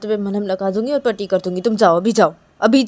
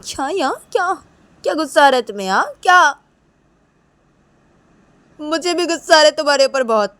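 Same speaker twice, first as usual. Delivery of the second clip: higher-pitched, indignant challenge; angry retort; 10 semitones higher, 16 dB louder than usual. Acted. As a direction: throw the anger right back. Acted quarrel, Reshma angry back.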